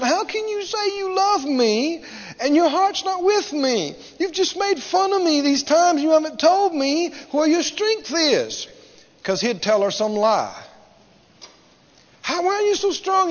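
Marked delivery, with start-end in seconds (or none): 0.0-8.7 high-pitched, wheedling mock complaint; tearful pleading woman. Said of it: "she's crying and carrying on". 12.2-13.3 high-pitched woman's wheedling question; lightly voiced pleading girlfriend; coaxing woman. Voicing Delilah coaxing Samson.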